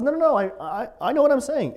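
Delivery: insistent protest. Acted. Paul protesting to the governor, high and insistent. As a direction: push back hard: I know what I'm saying.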